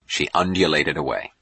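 plain reading voice

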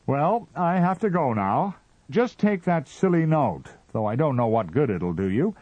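high and loud throughout; dismissive Martian. A narrator's reading. Mister Ah Ah brushes off the visitors, high. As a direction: brush them off, busy and peevish.

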